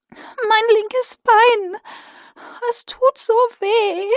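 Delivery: high, slow whimpering creature voice; sobbing. Acted. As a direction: sob it out in pain. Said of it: "Minka schluchzte".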